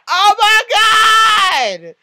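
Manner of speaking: high, loud, very drawn-out squeal; excited fan scream. Retold or imitated. Fan seeing her favorite on screen, excited scream. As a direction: shout it high and stretch every word.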